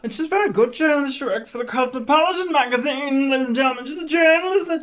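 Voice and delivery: high, insistent mock announcement; impersonated TV host. Acting out [name] outing contestant.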